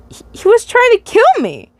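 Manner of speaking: high, bright and breezy, as if it's nothing; oddly cheery. Acted. The dreamer telling the guard about the attacker, oddly cheery. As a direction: chirp it out cheerfully despite the danger. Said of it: "you say with an oddly cheery tone".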